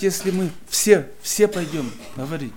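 usual speaking voice

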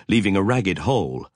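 plain reading voice

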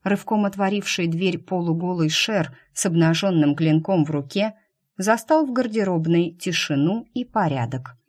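ordinary delivery

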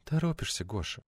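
very soft, intimate murmur; tender hushed tease; whispered. A narrator's reading. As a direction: drop to a near whisper, falling away. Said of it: "прошептала".

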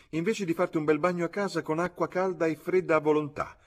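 high-pitched motherly reproach; indignant, high mother's telling-off; scolding mother. A narrator's reading. Mother scolds her bathing son.

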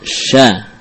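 "sya" opening straight from SH into A; opened directly. Shown as a demonstration. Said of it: "langsung saja dibuka". ("sya" langsung dibuka tanpa bunyi ya)

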